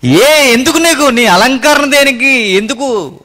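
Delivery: taunting jab. Retold or imitated. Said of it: "ఈ డైలాగులు ఉండవు స్త్రీల మధ్య".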